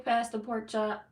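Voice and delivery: drawn-out 'Pass the pork chop' in a playful Southern country twang; mock Southern country accent. Reading a viewer's name back on a live stream in a playful Southern country accent. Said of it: "Country girl, I hear that accent".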